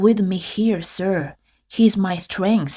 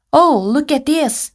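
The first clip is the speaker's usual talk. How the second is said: ordinary talk, nothing marked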